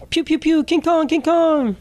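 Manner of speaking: put-on high, slow kid chant of King Kong; excited little kid. A small son cheering King Kong, high and slow. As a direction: chant it like a thrilled child at the theater.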